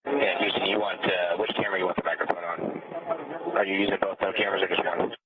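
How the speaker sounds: crackly radio transmission; like a space-to-ground radio link; astronaut radio. Real, not acted.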